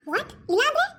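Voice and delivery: high indignant what; outraged what. Acted. Daughter-in-law shocked when mother-in-law threatens her. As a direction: bristle at the threat.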